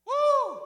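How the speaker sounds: high, loud "woo"; celebratory whoop. Retold or imitated. Rafter celebrating with the raft, high loud whoop. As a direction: whoop like you just survived.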